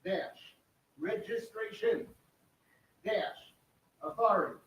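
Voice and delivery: softly dictated piece by piece, long pauses; one word at a time, quiet and deliberate; slow dictation. Shown as a demonstration. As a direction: read it slowly for someone typing. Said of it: "just read it again slow".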